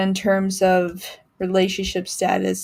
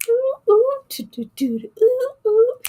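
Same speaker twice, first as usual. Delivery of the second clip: playful high whoops; 6 dB louder than usual. Retold or imitated. Mock bedroom excitement sounds.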